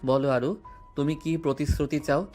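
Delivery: plain reading voice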